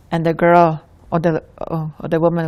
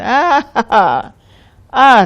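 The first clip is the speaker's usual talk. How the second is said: hearty acted laugh, high and loud; loud storytelling laugh, pitched way up; boy's laughter; 7 semitones higher, 11 dB louder than usual. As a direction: burst out laughing as the boy. Retold boy laughing in story.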